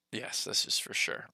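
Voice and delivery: whispered aside; whispering. Real, not acted. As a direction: whisper it off mic. Whispered aside to someone off camera.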